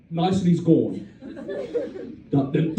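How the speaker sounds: ordinary talk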